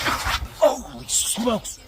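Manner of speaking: sudden high startled cry; shocked outburst. Real, not acted. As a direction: blurt it out in real shock. Spirit box speaks, he jumps.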